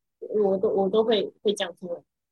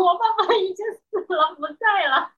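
laughing retold confession; 7 semitones higher than usual. Laughing retelling of blurted grief.

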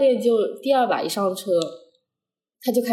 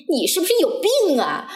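higher-pitched retold outburst; mock angry scolding; 8 semitones higher than usual. Instructor tells off the learner after a failed exam, in mock scolding. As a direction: imitate an instructor berating a student. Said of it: "他就在那个车上一直骂我，".

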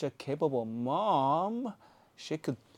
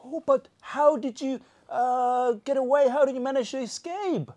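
lightly voiced Pooh; 7 semitones higher, 4 dB louder than usual. Voicing Pooh asking questions.

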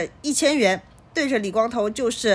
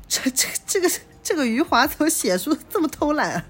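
laughing aside; 6 semitones higher than usual. Real, not acted. Reader breaks off laughing at author.

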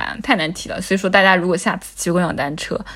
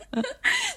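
high burst of laughter; 12 dB quieter than usual. Real, not acted. Laughing hard at friend's story.

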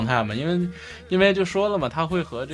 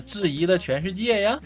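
Jinzhou accent, 呀 rising at the end; 7 semitones higher than usual. Shown as a demonstration. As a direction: rise at the end, Jinzhou style. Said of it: "它的尾音会往上扬啊".

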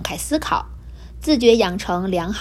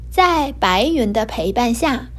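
ordinary delivery

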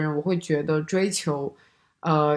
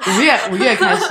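louder, higher words through laughter; laughing; 4 semitones higher, 8 dB louder than usual. Real, not acted. Laughing as her friend picks her May book. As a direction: talk while still laughing.

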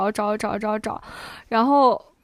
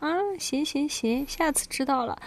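softer, higher-pitched mock compliance; placating dad; 3 semitones higher, 6 dB quieter than usual. Dad giving in to his upset daughter, softly placating. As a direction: imitate a dad giving in just to end it.

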